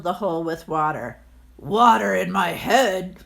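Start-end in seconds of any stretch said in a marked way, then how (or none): 1.6-3.2 grumpy landlord. Acted. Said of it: "said the landlord".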